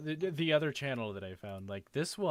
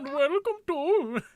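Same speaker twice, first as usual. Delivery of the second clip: slow, high, loud fake Indian accent; 10 dB louder than usual. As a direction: put on a hammy Indian accent. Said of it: "terrible Indian accent".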